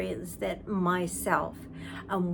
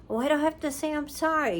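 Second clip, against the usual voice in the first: higher and fast, the ego protesting; indignant ego; 6 semitones higher, much faster than usual. Retold or imitated. Teacher voicing the ego refusing to apologize, indignant and rushed. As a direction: rattle it off, offended at being asked. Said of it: "Ego goes".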